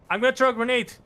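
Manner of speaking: loud, high shouted warning; grenade warning yell. Shown as a demonstration. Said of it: "A lot of people do this. Grab the grenade".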